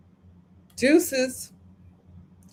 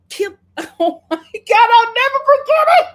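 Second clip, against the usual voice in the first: high, loud laughing through the words; 11 semitones higher, 8 dB louder than usual. Real, not acted. Host breaks into laughter remembering the weave scene. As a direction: crack up while recalling it.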